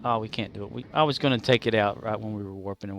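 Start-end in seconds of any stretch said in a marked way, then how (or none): none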